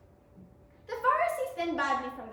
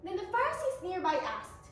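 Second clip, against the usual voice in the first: usual speaking voice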